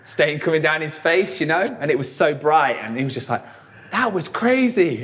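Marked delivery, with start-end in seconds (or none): 3.9-5.0 dazed bandmate. Retold or imitated. Dazed bandmate after bloody gig.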